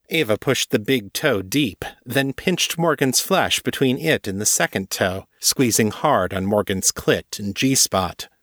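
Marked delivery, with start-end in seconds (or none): none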